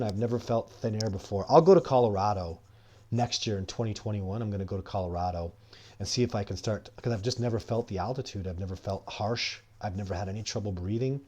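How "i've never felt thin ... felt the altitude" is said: usual speaking voice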